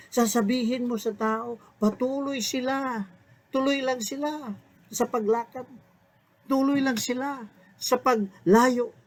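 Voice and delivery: a preacher's lifted, pleading divine command; voicing the Lord. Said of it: "sabi ng Panginoon kay Moses".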